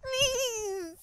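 high, drawn-out whiny begging; pleading. Acted. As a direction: beg a friend in a high, whimpering voice. Begging for an introduction.

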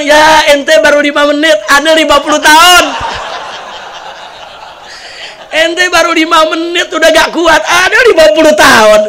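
husband laughing back at the worn-out jinn; laughing retort. Retold or imitated. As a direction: rub it in with a grin. Husband's punchline reply to jinn.

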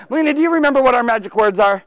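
usual speaking voice

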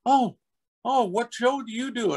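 put-on high-pitched eager child voice; eight-year-old kid. Little kid questions actor at hotel pool, eager high child voice. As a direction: ask as an excited little boy. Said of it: "he was about eight".

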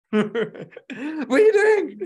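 laughing mock fright; imitating scared mum. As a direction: play his jumpy mum, laughing as you do her. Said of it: "She gets so scared".

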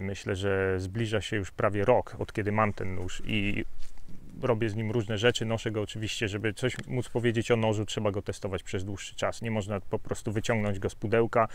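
ordinary talk

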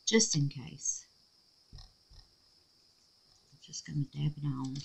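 soft, slow murmur while concentrating; barely above a breath, careful; hushed and slow. Real, not acted. Crafter murmurs to herself while dabbing, hushed and slow. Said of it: "Y'all, I'm quiet, ain't I?".

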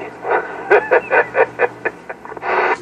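breathy cackle shooting up high; high mocking laugh. Real, not acted. Player laughing at rival, high breathy cackle. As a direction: laugh at him high and airy.

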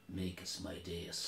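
low, slow tough-guy menace; mock cowboy one-liner, quiet and unhurried; Clint Eastwood. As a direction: deliver it very softly, drawn out and deep. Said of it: "Clint Eastwood".

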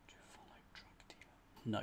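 whispered under the breath. Real, not acted. Solver whispering the clue to himself, in a whisper. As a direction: murmur the clue to yourself in a whisper.

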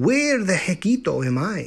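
put-on lightly accented puzzled kitten; wondering aloud. A narrator's reading. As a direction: ask it in bewilderment. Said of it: "wondered Skipito aloud".

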